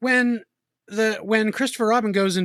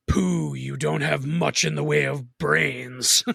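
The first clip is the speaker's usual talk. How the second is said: villain voice; much slower than usual. Retold or imitated. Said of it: "I love that he says".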